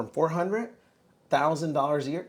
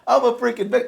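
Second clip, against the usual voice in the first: self-pitying whine; 10 semitones higher, 10 dB louder than usual. Retold or imitated. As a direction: ham up feeling sorry for yourself. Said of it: "I felt so sorry for myself".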